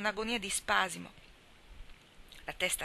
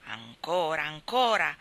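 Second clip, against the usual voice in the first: louder growled demand, falling; growling monster; 2 semitones lower, 6 dB louder, slower than usual. Acted. The cat-mouse idol demanding more offerings, growling. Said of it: "ringhiò una voce terribile".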